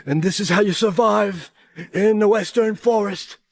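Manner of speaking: slow, mock survival-show host; Bear Grylls. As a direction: drawl it like a TV survivalist. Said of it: "I'm Bear Grylls".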